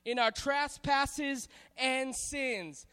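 each word drawn out slowly; solemn slow recitation; slow scripture reading. Shown as a demonstration. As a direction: read it slowly and deliberately. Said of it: "it says this".

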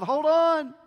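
loud, high interrupting shout; acted, booming objection; calling to crowd. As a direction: halt the whole crowd with a shout. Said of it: "says to the crowd".

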